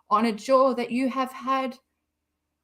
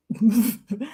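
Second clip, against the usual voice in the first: laugh; 4 dB louder than usual. Real, not acted. Laughing at own joke.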